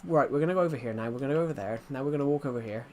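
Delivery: quick-fire running commentary. Shown as a demonstration. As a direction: rattle it off fast, hard to follow. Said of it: "if I talk like that, you guys wouldn't understand me".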